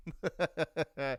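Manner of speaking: quick staccato chuckle. Real, not acted. Streamer tickled by own joke, light chuckle. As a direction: laugh lightly at your own bit.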